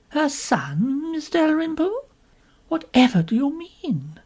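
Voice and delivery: startled but still polite; a touch of ladylike astonishment; mild surprise. A narrator's reading. Narrator astonished by news.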